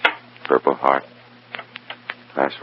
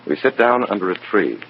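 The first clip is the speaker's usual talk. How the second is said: low, measured first-person noir narration; noir story narration; 4 semitones lower than usual. Acted. Agent narrating how he handles the dead soldier's pouch, in a measured noir voice. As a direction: tell it like a tired detective looking back.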